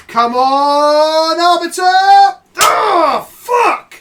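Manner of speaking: high, full-volume yell; will the card in at the top of your voice; excited shouting. Real, not acted. Streamer begging for Arbiter off the draw, real shout.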